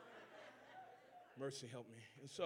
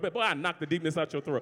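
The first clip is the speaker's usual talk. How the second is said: ordinary talk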